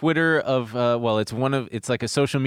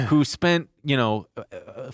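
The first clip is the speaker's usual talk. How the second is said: usual speaking voice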